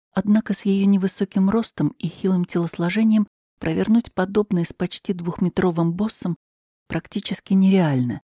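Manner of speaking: ordinary delivery, nothing marked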